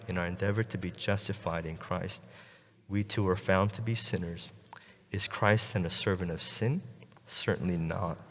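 soft, reverent reading of the verse; scripture reading. Acted. Pastor reads the verse aloud to the congregation, soft and reverent. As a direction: read it softly and solemnly, like holy text.

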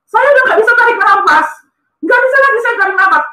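high-pitched, strained re-enactment of breathlessness; retold panic. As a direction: act out struggling for air, pitch jumping up. Acting out his breathless panic.

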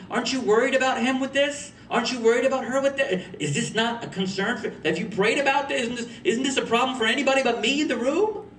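high, incredulous questions piled up; worried challenge, voice climbing high; exasperated questioning. Retold or imitated. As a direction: plead in disbelief, pitched well up. Exasperated questions at red flags.

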